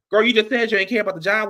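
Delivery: normal talking voice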